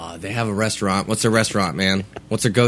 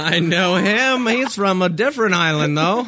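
mock echo; 11 semitones higher, 4 dB louder than usual. Teasing echo of Crocodile Dundee.

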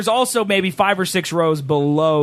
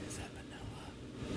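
unvoiced whisper, very quiet; retold whisper; 28 dB quieter than usual. Retelling Chris's question in the dark theater, whispering. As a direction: whisper it so the theater can't hear.

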